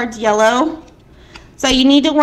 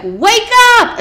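high, loud mock shout; imitating a yell; 9 semitones higher, 5 dB louder than usual. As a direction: bark a wake-up call like grandma. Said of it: "she's, like, screaming at me".

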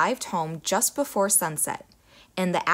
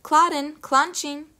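slow higher motherly call; mother's voice; 8 semitones higher, 4 dB louder than usual. A narrator's reading. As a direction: sound just like the mother. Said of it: "she sounded exactly like the girl's mother".

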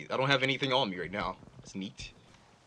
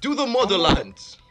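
put-on loud, higher Russian-accented rallying cry; cool foreign spy menace; 8 semitones higher, 7 dB louder than usual. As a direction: shout it like a Soviet soldier. Rallying cry as Soviet comrade.

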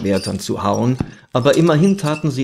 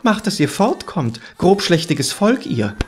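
high, mildly outraged scolding; indignant; 6 semitones higher than usual. A narrator's reading. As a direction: scold them with raised pitch. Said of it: "empörte er sich".

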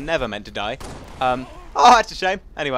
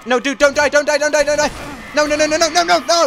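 loud, high, rapid-repeat panic; frantic pleading; 10 semitones higher, 6 dB louder than usual. Pretend. Player begs his teammate not to die, frantic pleading. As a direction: beg at the top of your pitch.

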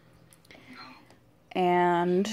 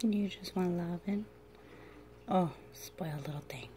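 hushed, breathy whisper to a sleepy pet; soft whisper; 2 semitones lower, 11 dB quieter than usual. Real, not acted. Owner whispers to her drowsy dragon, soft whisper. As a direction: whisper so he drifts off.

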